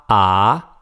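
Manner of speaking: AH climbing in pitch; rising tone. Shown as a demonstration. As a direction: sweep AH upward. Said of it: "are the four tones".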